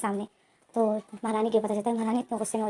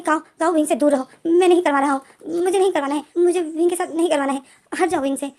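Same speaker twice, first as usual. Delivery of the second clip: raised, louder retold command; angry order; 4 semitones higher, 7 dB louder than usual. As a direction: push Kao away with your voice. Lenjen orders Kao away. (लेनजेन गुस्से में ज़ोर से काओ को रोकता है)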